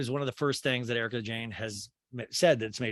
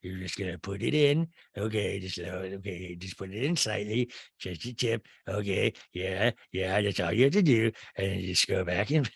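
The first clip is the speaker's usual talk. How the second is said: Rinna; 4 semitones lower than usual. Retold or imitated. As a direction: imitate her hushed, earnest ramble in bed. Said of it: "Did she talk this way during sex?".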